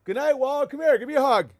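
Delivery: put-on a cooing parent tucking kids in; mock sweet goodnight. Mocking the chat, cooing a loud high goodnight. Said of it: "I'm like".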